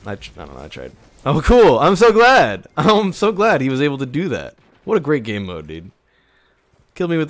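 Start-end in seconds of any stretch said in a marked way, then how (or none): 1.2-4.5 loud, fake delight with a laugh; sarcastic cheer. Real, not acted. Sarcastic cheer after teammate fails. 4.8-5.9 sarcastic praise. Real, not acted. Sarcasm about the game mode.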